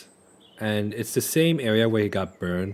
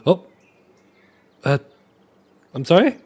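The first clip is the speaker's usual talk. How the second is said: startled apology; 7 semitones higher, 7 dB louder than usual. Acted. GM voices startled Riolus.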